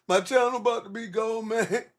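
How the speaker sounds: high, whiny imitation of someone crying; mock crying whine. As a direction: mimic a crybaby whining.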